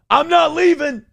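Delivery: mock defiant stockbroker declaration; Jordan Belfort line. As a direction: belt it out like the Wall Street speech. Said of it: "do the Jordan Belfort thing".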